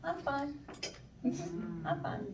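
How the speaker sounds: a clipped, quiet, sulky I'm fine; passive aggressive fine. Retold or imitated. Wife brushing off her husband, passive aggressive. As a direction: say fine so he knows it isn't. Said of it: "You know that fine".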